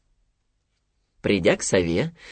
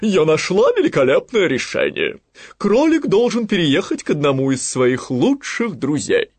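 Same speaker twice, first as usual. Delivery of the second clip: raised, louder wise owl proclaiming; 5 semitones higher, 5 dB louder than usual. Acted. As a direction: announce the brilliant idea as the owl. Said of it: "вдруг воскликнула она". (громкий торжественный голос совы)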